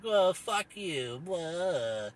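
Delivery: very slow, drawn-out sneer; lightly voiced smug sneer; mocking smug lefty. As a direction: parody a smug activist. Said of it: "smug left-wing dickheads are like".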